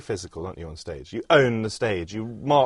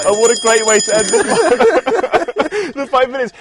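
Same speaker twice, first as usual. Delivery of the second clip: high, loud laughing delight; 10 semitones higher, 8 dB louder, slower than usual. Real, not acted. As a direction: laugh through the words. Host laughing at diarise.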